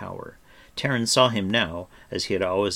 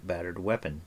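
usual speaking voice

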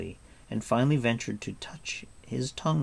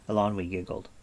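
ordinary delivery

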